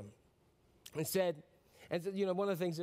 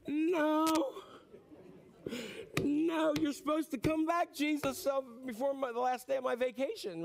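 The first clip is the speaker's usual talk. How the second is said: mock wailing plea; 8 semitones higher than usual. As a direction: beg like a kid robbed of vacation. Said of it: "you're like".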